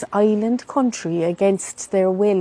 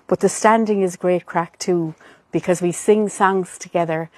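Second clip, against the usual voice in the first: ordinary talk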